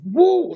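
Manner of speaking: high, loud joyful whoop; excited whoop. Retold or imitated. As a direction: let out a happy woo. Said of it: "and he just".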